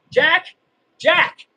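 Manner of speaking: loud, high, rising name call; exasperated call-out. Real, not acted. Scolding a commenter named Jack.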